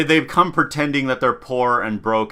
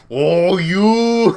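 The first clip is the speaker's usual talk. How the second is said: hammed-up, higher mock outrage; fist-waving fury; 5 semitones higher than usual. Rival mom shaking her fist, mock fury. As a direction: draw out a comic angry cry. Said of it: "waving her fist in the air".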